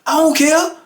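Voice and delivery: high-pitched mock yell; retold screaming, voice shot way up; imitated scream. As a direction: yell it defiantly without much added volume. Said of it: "So he scream on him".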